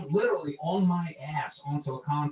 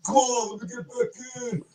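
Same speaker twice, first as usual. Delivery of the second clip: put-on pleading drunk; 9 semitones higher than usual. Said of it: "I'm like".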